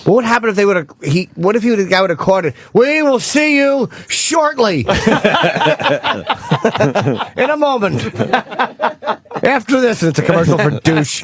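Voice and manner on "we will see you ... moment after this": home run call. Retold or imitated. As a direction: announce it like a big TV play-by-play man, laughing through it. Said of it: "You gotta put some spunk in a home run call".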